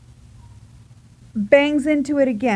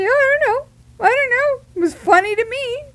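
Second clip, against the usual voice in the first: high, louder mock-innocent voice; amused mother; 13 semitones higher, 4 dB louder than usual. Mother excusing herself for laughing, high amused protest. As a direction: pitch it way up, tickled and unapologetic. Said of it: "she still was laughing".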